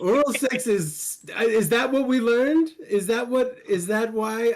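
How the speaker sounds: high, mock-shocked questions; incredulous. Real, not acted. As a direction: play up the disbelief.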